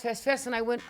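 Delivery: normal talking voice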